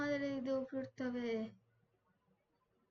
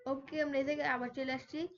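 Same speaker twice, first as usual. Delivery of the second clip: ordinary delivery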